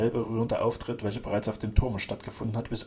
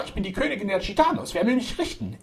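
high-pitched indignant declaration; proud defiance; 9 semitones higher, 4 dB louder than usual. A narrator's reading. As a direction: proclaim it like a defiant queen. Zaba defies her arrest.

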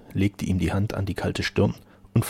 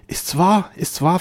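high, loud, urgent questioning; overjoyed disbelief; 10 semitones higher, 8 dB louder, slower than usual. A narrator's reading. Otto kneels before the duke asking if true, amazed and high. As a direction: burst out in amazed hope.

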